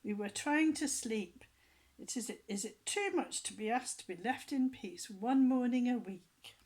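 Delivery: angry scolding. A narrator's reading.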